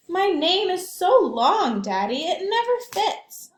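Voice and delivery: put-on high, slow complaining child; little girl voice. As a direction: play a small girl whining to her daddy. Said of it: "Alma said".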